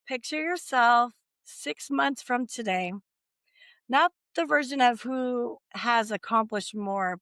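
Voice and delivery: calm, slow visualization voice. Acted. Guided visualization.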